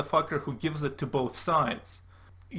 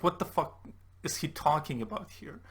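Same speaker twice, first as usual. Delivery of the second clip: usual speaking voice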